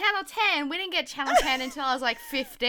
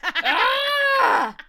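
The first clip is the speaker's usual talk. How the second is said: high, loud yell breaking into laughter; laughing yell; 12 semitones higher, 8 dB louder than usual. Real, not acted. Yelling at Flash Player, laughing frustrated yell. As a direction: yell and crack up. Raging at Flash update prompt.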